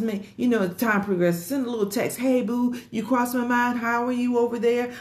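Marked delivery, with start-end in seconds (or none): 2.2-4.9 lilting high friendly hello to a friend; high, affectionate check-in with a sister friend; sweet greeting. Retold or imitated. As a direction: coo it like a text to your girlfriend. Voicing her friendly text to friends.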